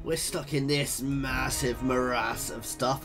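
usual speaking voice

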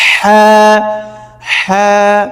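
breathy pharyngeal h held on হ্যাঁ; haa-e hutti. Shown as a demonstration. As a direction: draw out হ্যাঁ from mid-throat. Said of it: "হ্যাঁ হত্যি".

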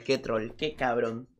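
ordinary talk, nothing marked

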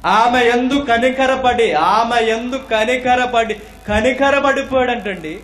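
high, loud, slowly stretched scripture proclamation; emphatic preaching. Acted. Preacher repeating a verse about compassion to congregation, emphatic proclamation. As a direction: proclaim the verse at full force.

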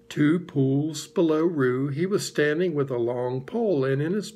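usual speaking voice

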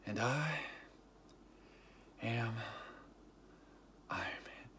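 soft, spent, with long pauses; halting, hushed last words; exhausted hero. Retold or imitated. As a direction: imitate a man using his last strength, fading to a whisper. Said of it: "he's just exhausted".

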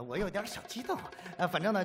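ordinary delivery

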